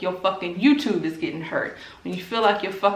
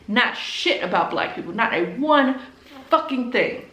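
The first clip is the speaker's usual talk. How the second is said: ordinary delivery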